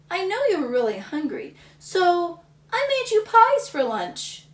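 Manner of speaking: high, sweet motherly offer; mom's voice. Acted. Mom telling Samuel she made pies, high and bright.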